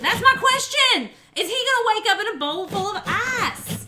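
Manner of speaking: very high, loud exclamation; excited outburst. Real, not acted. Streamer seizing on a viewer's kidney theory, excited shout.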